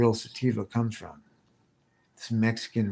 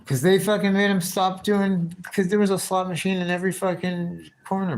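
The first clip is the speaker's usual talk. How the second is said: higher and louder, all fired up; worked-up rant; 8 semitones higher, 5 dB louder than usual. Real, not acted. Streamer fires up telling the caller about banned slot machines, loud and high. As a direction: get heated about it.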